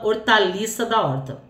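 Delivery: plain reading voice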